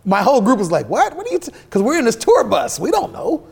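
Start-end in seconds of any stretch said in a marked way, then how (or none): 0.9-1.5 stunned. Retold or imitated. Group stunned by news.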